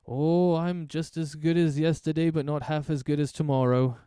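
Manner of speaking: hearty trader greeting a customer; jolly shopkeeper. Acted.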